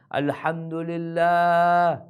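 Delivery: a long, slow, drawn-out breath of gratitude; relieved thanks. Acted. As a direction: stretch it out like a man who barely survived. Man thanking God after escaping hell.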